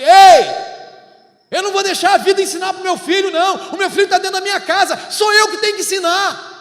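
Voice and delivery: high, loud fiery preaching shout. Real, not acted. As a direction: hammer it home at full force. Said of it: "preaching at full force".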